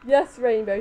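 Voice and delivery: ordinary talk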